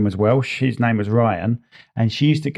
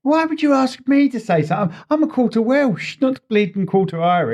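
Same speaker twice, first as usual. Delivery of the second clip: high-pitched indignant protest; mock indignation; 10 semitones higher than usual. Real, not acted. Asked to speak Irish, protesting in a high indignant voice. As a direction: squeak out the protest in mock outrage.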